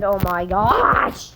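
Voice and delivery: drawn-out, rising cry of dismay; sudden frustrated outburst at the game; real exasperation. Real, not acted. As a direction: blurt it out, stretching the last word. Reacts to the game mid-story.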